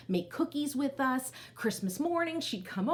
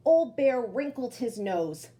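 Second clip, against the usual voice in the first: slow, drawn-out read-aloud voice; storytelling narration; 5 dB louder, slower than usual. A narrator's reading. Teacher reading to children, slow storybook narration.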